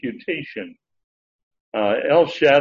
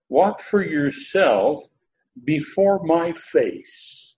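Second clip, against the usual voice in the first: very slow, higher-pitched scriptural command; deliberate command; 4 semitones higher, much slower than usual. Retold or imitated. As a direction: spell out the command word by word, drawn out. Quoting God's command, translated slowly.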